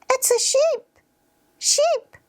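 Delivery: put-on very high-pitched child answering; squeaky small kid naming an animal; little child.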